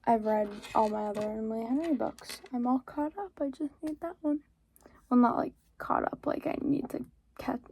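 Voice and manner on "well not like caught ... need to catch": whispered correction to herself. Real, not acted. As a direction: hush it like a side note. Whispered self-correction aside.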